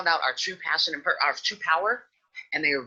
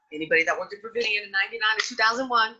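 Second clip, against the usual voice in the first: ordinary talk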